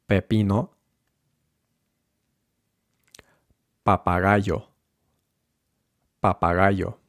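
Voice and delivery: unaspirated p in Pepino and Papagayo; even syllables on Papagayo, lips curled in; muted p on Pepino and Papagayo; Spanish p. Shown as a demonstration. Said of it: "with the P, you can curl your lips".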